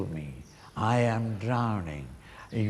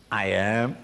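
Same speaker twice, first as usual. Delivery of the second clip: usual speaking voice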